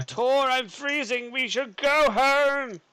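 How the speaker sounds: high, slow whiny plea; whiny pleading. Retold or imitated. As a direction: whine like a freezing child. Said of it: "I'm like".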